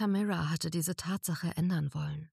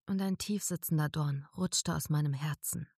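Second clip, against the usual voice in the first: ordinary delivery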